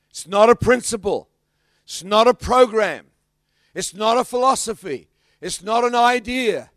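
loud, hammering preacher's denials; loud emphatic preaching list; preaching at full force. Acted. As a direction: pound each "It's not a" line at the crowd.

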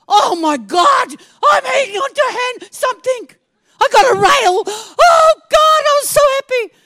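high, loud shouting relief, near tears; overjoyed shout. Retold or imitated. Grabbing the rail at last, high loud joyful shout. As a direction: cry out with joy and relief. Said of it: "I thought".

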